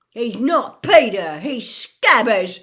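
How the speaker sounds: full high yell, drawn out on the last words. A narrator's reading. Ron defending his rat to Lupin, yelling. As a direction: yell it in outraged denial. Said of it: "Ron yelled".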